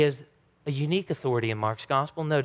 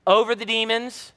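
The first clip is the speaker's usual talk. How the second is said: ordinary delivery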